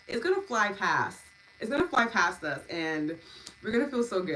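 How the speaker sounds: excited. Real, not acted. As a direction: burst out with real excitement. Host excited about next day.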